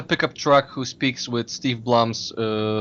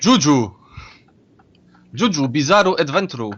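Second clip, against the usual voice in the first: put-on Japanese accent. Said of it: "pretty decent Japanese accent".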